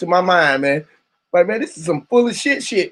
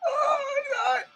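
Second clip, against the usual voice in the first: high wailing laugh; 9 dB quieter than usual. Real, not acted. Laughing fit on stream.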